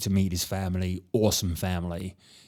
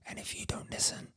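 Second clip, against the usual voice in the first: library whisper; 17 dB quieter than usual. Real, not acted. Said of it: "I'm only whispering because".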